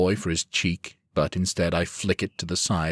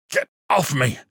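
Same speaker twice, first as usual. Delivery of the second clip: higher, louder furious cry; angry shout; 8 semitones higher, 5 dB louder than usual. A narrator's reading. Lord snapping at his guards, angry shout. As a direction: shove them off with a shout. Rage, pushing guards away.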